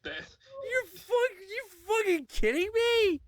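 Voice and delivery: high, cracking cry of disbelief; hammed-up shocked shriek. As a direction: scream it like you can't believe it. Shocked at hole in backyard.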